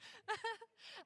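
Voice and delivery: high, helpless giggle; real laughing. Real, not acted. Host cracking up after the Unipig skit, real laughing. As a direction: crack up, unable to hold it in.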